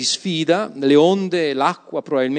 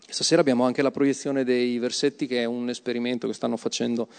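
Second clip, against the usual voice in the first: ordinary delivery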